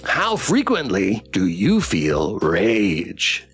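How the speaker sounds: robot pet sinking down on RAGE; drawn-out, measured questionnaire; ominous survey bot. Acted. Plush pet asks survey question.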